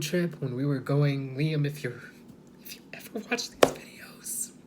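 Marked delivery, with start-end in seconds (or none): 2.6-4.7 whispering. Real, not acted. Whispered aside to Liam.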